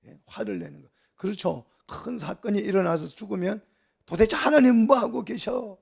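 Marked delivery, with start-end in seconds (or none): 4.1-5.8 higher, louder mock outrage; acted angry outburst. Preacher mimics unbelievers blaming God after a death, in loud indignation. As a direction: imitate someone railing at God. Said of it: "화를 내는 걸 말해요".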